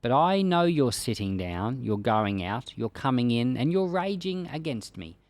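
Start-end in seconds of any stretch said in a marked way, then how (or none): none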